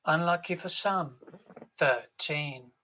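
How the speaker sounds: loud, drawn-out bingo calls; bingo caller. Acted. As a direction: call the numbers across the hall. Bingo caller calling numbers.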